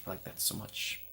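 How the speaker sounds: soft breathy murmur; hushed whispered delight. Real, not acted. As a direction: whisper it fondly.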